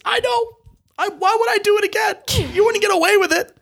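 very high hammed-up protest; mock indignation. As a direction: protest far too much. Mock-indignant reply to tribe.

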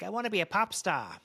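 high-pitched, playful impression of a young pop hopeful. Host imitates young singer dreaming of pop fame, playful impression. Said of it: "that's how he sounds, I think".

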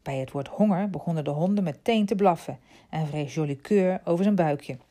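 ordinary delivery

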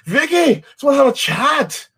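high, mock British accent, calling Vicky in; hammed-up British detective voice; mock British cop. Quoting a Line of Duty cop, mock British accent.

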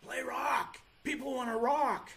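high-pitched, insistent shoulder voice. Acted. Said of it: "It looks like [name], and he's saying".

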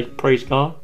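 "Praise God" said low and soft, barely there; a dull, dutiful "Praise God"; muted praise God. Shown as a demonstration. A dead church member murmuring "Praise God" softly. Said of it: "When's the last time you heard somebody".

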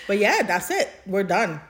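usual speaking voice

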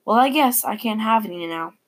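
ordinary delivery, nothing marked

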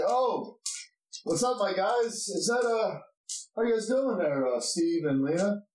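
put-on high-pitched, familiar greeting to old acquaintances; friendly dwarf guard. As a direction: hail returning friends, pitch climbing high.

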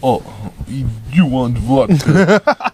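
slow, laughing put-on Russian accent; mock Russian accent. Joking about Russians' drinking age, slow mock Russian accent. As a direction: tease like a Russian uncle offering vodka, in a Russian accent.

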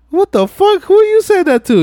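high, loud outraged squawk; indignant Mia. Retold or imitated. Mia outraged at Vince's car-wash order, high and loud. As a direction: shriek it back in disbelief.

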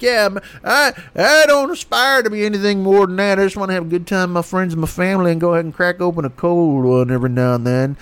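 high, easygoing bro voice; laid-back partier. Retold or imitated. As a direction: chill beer-loving dude. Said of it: "icebergers like".

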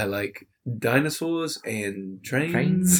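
put-on slow Australian accent, drawn-out last word; Shane Gillis. Contestant telling a date his interests, slow Australian accent. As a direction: imitate a comic's Australian dating-show contestant, slowly. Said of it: "the Australian accent a lot".

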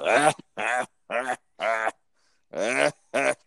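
high, spaced-out fake chuckle; Ed McMahon laugh. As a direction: mechanical ah-ah sidekick laugh, evenly separated. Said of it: "your Ed McMahon just".

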